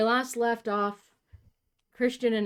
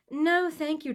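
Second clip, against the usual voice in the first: high, louder theatrical lady; mother's society voice; 10 semitones higher, 5 dB louder than usual. Acted. Mother's put-on voice in story.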